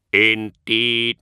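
slow, measured, strangely inflected machine voice; a polite machine reciting its excuses; synthetic Magos voice. Acted. The Magos explaining to the Astartes, a slow synthetic voice. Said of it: "Archoi's synthesized voice".

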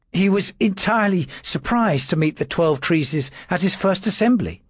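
high, thin timid clerk's voice; a frightened servant trying to sound firm; nervous little secretary. A narrator's reading. Dawes answering his lordship's questions, high and nervous.

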